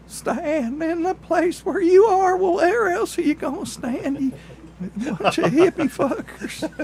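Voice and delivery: put-on high, drawling old hillbilly; old country feller voice. Mocking old-timer singing [name].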